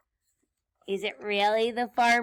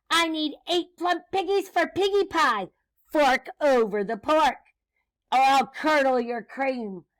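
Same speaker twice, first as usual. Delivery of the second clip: raised, punchy mock scream; witch's scream; 4 semitones higher, 7 dB louder than usual. Witch threatens cow for piggies, mock screaming demand. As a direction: threaten with hard-hit words. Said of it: "screamed Grinch".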